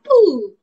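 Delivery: playful explosion noise; mock explosion. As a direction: act out the motor blowing up. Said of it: "but I'm thinking".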